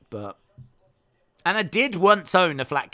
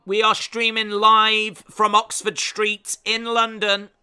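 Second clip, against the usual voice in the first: slow, louder put-on presenter delivery; mock live broadcaster; 5 semitones higher, 6 dB louder, slower than usual. Streamer pretending to be live from London, mock broadcaster. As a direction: announce it grandly, like a live broadcast.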